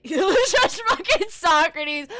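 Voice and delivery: laughing. Real, not acted. Laughs at Socrates sticker.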